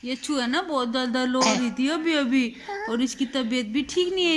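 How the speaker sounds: usual speaking voice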